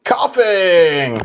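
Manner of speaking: lightly voiced creature cry; Pokemon voice. Friend calling out the Pokemon's name, lightly voiced creature cry. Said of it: "I just did his voice".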